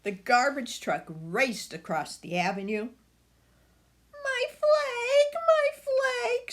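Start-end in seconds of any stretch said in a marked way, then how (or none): 4.1-6.5 put-on very high little-girl squeal; tiny girl's piercing squeal. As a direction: squeal like a small upset child, much louder. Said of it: "squealed little Maggie Wells".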